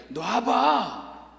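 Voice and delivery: stretched very slowly; drawn-out let go. Retold or imitated. God's last word to the man, stretched very slowly. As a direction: hold the word out long and patient.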